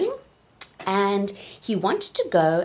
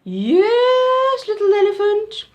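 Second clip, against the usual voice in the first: much higher and louder auntie voice; Auntie Giraffe voice; 12 semitones higher, 10 dB louder, slower than usual. Acted. Auntie Giraffe answering the little elephant, high and loud. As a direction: answer like a grand old giraffe aunt.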